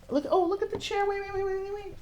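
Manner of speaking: high giddy delight at the chair; excited squeal. Acted. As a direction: squeal like you just spotted treasure. Spotting the bling chair.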